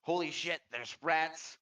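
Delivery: high-pitched mock cry of warning; acted alarm. Game master voices a player spotting the rats, alarmed. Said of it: "just enough time for you to say".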